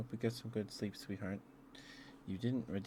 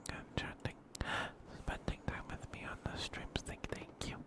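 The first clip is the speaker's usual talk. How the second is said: ASMR whisper; slower than usual. Acted. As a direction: whisper tenderly to soothe a viewer.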